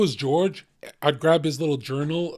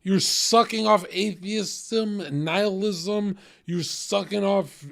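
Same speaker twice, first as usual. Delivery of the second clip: loud mocking heckle; 5 semitones higher, 4 dB louder than usual. Real, not acted.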